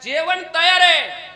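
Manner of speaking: loud acted reply from the kitchen; calling back. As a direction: call out high and slow to the husband. Wife voiced calling back to husband.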